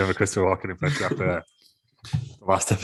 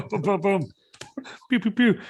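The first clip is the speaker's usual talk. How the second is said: gunshot noises; 12 semitones higher than usual. Pretend. Imitating shooting sounds.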